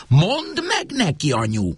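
high, pleading daughter's plea. Acted. Daughter begs her mother for backup, pleading high. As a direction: beg her mother to take her side. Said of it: "kérlelte Bea".

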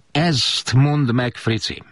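high, slow call across the table. Retold or imitated. Turcsányi teases Frici across the dinner table, high and slow. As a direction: draw it out, teasing him across the table. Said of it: "szólt át az asztalon".

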